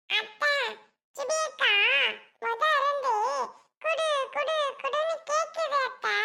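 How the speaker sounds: put-on high-pitched little child complaint; small child tattling. Child complaining to aunt about Subi, small child tattling. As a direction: tell on someone in a tiny child's voice.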